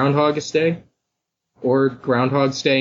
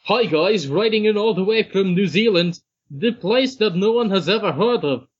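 put-on botched New Zealand accent, lilting and higher; 7 semitones higher than usual. Podcast host reading a listener's submission, attempted New Zealand accent. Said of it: "he's from New Zealand. What do they sound like".

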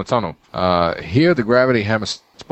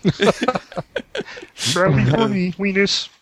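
squeaky puppet villain voice; dark lord; 10 semitones higher than usual. Pretend. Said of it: "make him sound like Kermit the Frog".

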